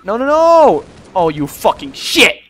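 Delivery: high shouted refusal into loud cursing; angry outburst. Real, not acted. Player cursing the game after failing, angry shouting. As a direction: explode at the game.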